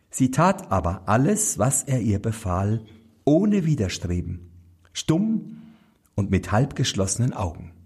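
usual speaking voice

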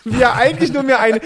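usual speaking voice

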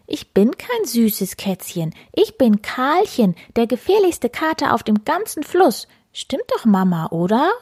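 high, huffy little tomcat; little cat protests being called cute; indignant kitten. Acted. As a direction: insist you are dangerous, offended. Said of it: "Die kleine Katze maunzt empört".

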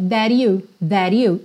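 "that you" linked with a flapped T. Shown as a demonstration. A teacher showing the flapped "that you". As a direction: tap the T so "that you" flows together. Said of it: "ela junta that com you, com rarará".

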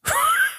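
a sudden squeaky laugh; short high giggle. Real, not acted. Host laughing at the guest's gloomy answer, high giggle.

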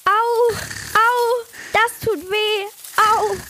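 high, pained yelps of hurt. Acted. The mouse Hilde squashed by the rolling hedgehog, in pain. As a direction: cry out as the hedgehog squashes you.

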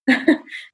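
brief amused chuckle; quick little laugh; short chuckle. Real, not acted. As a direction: chuckle at your own anecdote. Laughs after her spooky anecdote.

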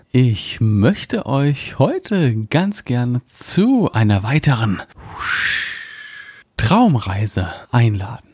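slow, low meditation voice; guided dream journey. Acted. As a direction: draw them into the journey slowly.

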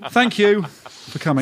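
slow laughing thanks. Real, not acted. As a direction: laugh it out warmly. Laughing sign-off.

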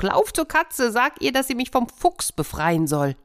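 very high desperate peacock voice; urgent high cry; peacock calling. Acted. Peacock begging the hen for help, high and urgent. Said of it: "kreischte der Pfau".

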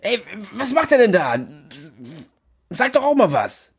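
high half-laughing disbelief; baffled disbelief. Acted.

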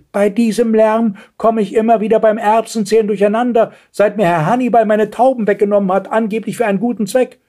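put-on high-pitched indignant old lady. A narrator's reading. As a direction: complain loftily in a high voice. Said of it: "rief empört". (empörte alte Dame, hohe Stimme)

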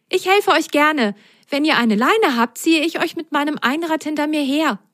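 high, helpful little whale; high friendly kid voice; whale child voice. Acted. Whale child offers help.

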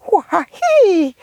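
high squeaky worm call; a tiny creature complaining of cold; worm noise. Acted.

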